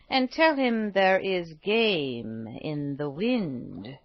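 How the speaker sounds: put-on slow, grave old woman with a secret. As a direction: deliver a slow mysterious message.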